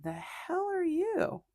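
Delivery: offended cat. Pretend. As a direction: drag it out in disbelief as the put-out old cat. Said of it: "Robbie's just looking at her like".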